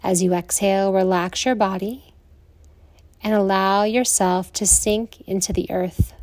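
soothing Yoga Nidra guidance with long pauses; guided meditation. Acted.

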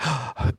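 short, quiet gasp of fright; scared gasp. Retold or imitated. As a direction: gasp as if fleeing in a nightmare. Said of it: "like, so scared and running".

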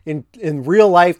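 ordinary talk, nothing marked

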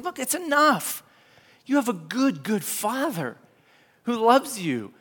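gentle reassurance. Retold or imitated. Peter reassuring believers.

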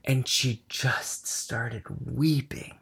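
whispered softly, barely voiced; hushed whisper. Real, not acted. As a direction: drop to a hushed whisper to tell it. Hushed retelling of crying.

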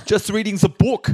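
high-pitched German badly posing as British; German accent. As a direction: innocent cover story in a thick mock-German accent. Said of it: "a German person pretending to be British".

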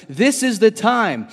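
loud, high preacher's declaration; ringing declaration as Jesus; emphatic proclamation. Retold or imitated. Voicing Jesus announcing his moment.

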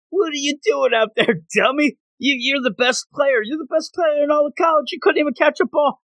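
high-pitched hammed-up coach scolding his player; mock coach yelling. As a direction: coach chewing out his star player after a lost game, high mock yell. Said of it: "yelling at him".

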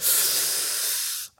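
soft breathy pained sigh; Peter Griffin groan. Retold or imitated. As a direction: sigh out like a cartoon dad hurting. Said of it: "that Peter Griffin thing".

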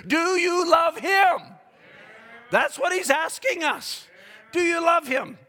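fervent preacher's appeal; preaching shout. Acted. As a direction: drive the question home. Climax of altar appeal.

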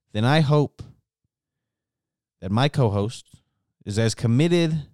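ordinary talk, nothing marked

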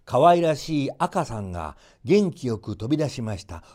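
plain reading voice

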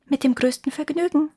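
high bird voice at normal volume; little nightingale. A narrator's reading. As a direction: answer obligingly as a small, high-voiced bird. Nightingale answers in the story. (hohe Stimme einer kleinen Nachtigall)

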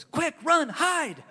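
put-on high, loud frantic child's alarm; a four-year-old yelling to flee, half-laughing; panicked kid. Little son panicking after smashing grandpa's window, frantic high yell.

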